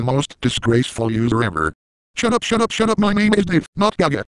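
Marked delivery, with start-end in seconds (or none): 2.1-4.2 much higher, fast squeaky voice; high sped-up Sam voice. Pretend. Fake Dave shouting at the uploader, squeaky high voice. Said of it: "He's also not the real Dave".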